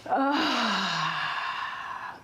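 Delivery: long sighing "ah" on the out-breath; deep exhale. Shown as a demonstration. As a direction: breathe out fully with an audible ah. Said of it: "deep inhale".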